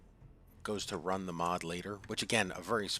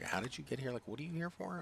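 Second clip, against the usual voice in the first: retold surprised question, higher pitch; mock astonishment, pitched up; amazed coworker; 7 semitones higher, 5 dB quieter than usual.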